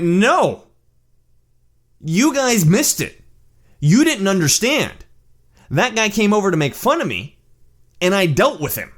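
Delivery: high, emphatic self-defence; retold heated correction; insistent rebuttal. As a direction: set them straight with drawn-out stresses. Insists to friends they misunderstood.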